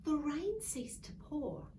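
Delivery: ordinary delivery, nothing marked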